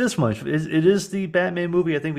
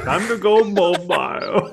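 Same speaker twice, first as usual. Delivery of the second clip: high, drawn-out film-quote voice; mock movie villain; 6 semitones higher, 3 dB louder, slower than usual. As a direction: stretch the line in a raised, put-on voice. Quoting a film character's line.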